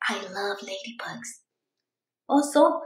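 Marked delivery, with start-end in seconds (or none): 0.1-1.3 soft unvoiced whisper; hushed whisper of love for ladybugs. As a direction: whisper it like a secret.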